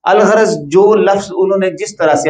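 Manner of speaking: ordinary delivery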